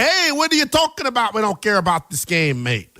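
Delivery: bad Canadian accent; lightly put-on Canadian accent. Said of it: "That was probably a bad Canadian accent".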